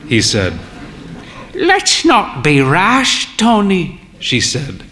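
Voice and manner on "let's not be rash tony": lightly voiced old lady, higher and slow; a hint of a proud old dear, higher; frail old mother. A narrator's reading.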